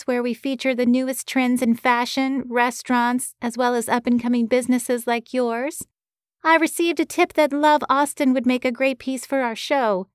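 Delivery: put-on thick Boston accent, pinched through the nose; a Boston producer on the phone, nasal and thick-accented. A narrator's reading. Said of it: "A nasally voice came through the line in a thick Boston accent".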